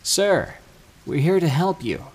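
high, loud call to a distant man. A narrator's reading. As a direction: call out to him across the woods. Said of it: "so I calmly call out".

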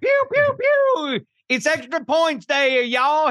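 high, slow hammed-up cowboy drawl. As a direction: play a rootin'-tootin' cowboy. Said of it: "Talking like a cowboy".

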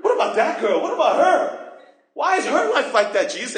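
mock-whiny, rising complaint with a laugh; teasing impression of a jealous complainer; whiny complaining. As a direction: play the jealous believer grumbling at God. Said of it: "And you start complaining".

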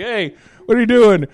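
high, loud goofy radio call; loud walkie-talkie Vader. Pretend. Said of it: "He's like".

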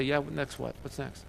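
quiet, bored, throwaway; bored shrug. Retold or imitated. Churchgoers shrugging off the miracle, quiet and bored. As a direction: brush it off with no interest. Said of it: "we sat there and say".

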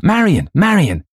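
high, loud, repeated cry. A narrator's reading. Louise gets no answer from daughter, crying out. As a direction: cry the name in rising alarm. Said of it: "Louise cried out".

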